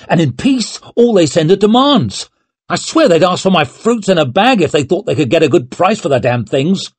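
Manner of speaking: high, loud, fast rant; furious rant. A narrator's reading. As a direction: rage at the absent king. Leo raging at the table.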